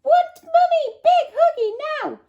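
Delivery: put-on very high, loud toddler wail; screaming baby. A narrator's reading. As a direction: demand a cuddle like a clingy baby. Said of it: "screamed the baby".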